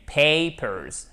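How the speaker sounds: "papers" as PAY-perz, with a long gliding "ay"; stressed diphthong. Shown as a demonstration. Teacher explaining the diphthong, papers as PAY-perz. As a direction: glide through the "ay" in "papers". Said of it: "C'est comme le A de Osei".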